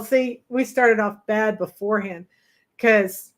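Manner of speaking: usual speaking voice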